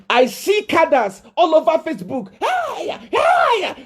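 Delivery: high, shrill mock cry of Hiya; mocking shriek. Preacher mimics Facebook cadres with a high mocking shriek. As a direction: shriek it like a jeering crowd.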